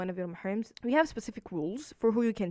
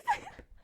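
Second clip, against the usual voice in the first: high giggle. Real, not acted. Delighted at the gift.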